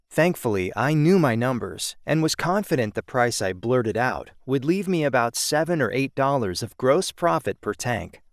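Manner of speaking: usual speaking voice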